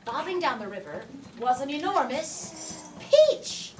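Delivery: ordinary delivery, nothing marked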